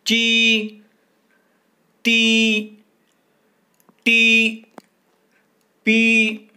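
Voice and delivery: की खी गी घी called out high and loud, each held on the long ई; drilled syllables. Shown as a demonstration. Teacher drilling pupils on the ई sign, calling out syllables. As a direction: chant each syllable to the class one by one. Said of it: "और भी उदाहरण देखेंगे".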